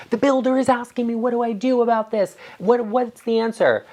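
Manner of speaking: high, anxious client voice, slowing down on the last question; a client asking the designer what the builder wants; worried client. Retold or imitated. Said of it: "I've had clients come to me and say".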